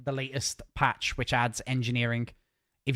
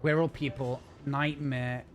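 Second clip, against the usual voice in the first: ordinary delivery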